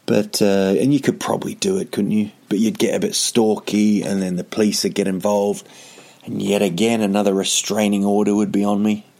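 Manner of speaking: ordinary talk, nothing marked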